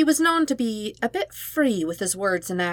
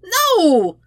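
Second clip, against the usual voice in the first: very high, loud outraged no; betrayed cry; 12 semitones higher, 7 dB louder than usual. Acted. Sokka cries out at losing to Zuko, very high and loud. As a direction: wail it like you've been robbed.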